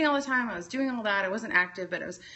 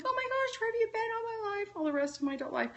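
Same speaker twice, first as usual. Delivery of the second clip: much higher, fast, gushing; gushing excitement; 5 dB quieter, much faster than usual. Retold or imitated. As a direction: squeal it like finding a long-lost friend. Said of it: "I'm like".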